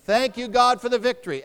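leading a call-out; out loud. Shown as a demonstration. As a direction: proclaim it high and loud for all to repeat. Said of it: "say it out loud".

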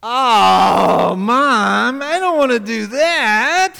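put-on high, loud whining kid; whiny child. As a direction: whine like a child refusing a chore. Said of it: "your child says to you".

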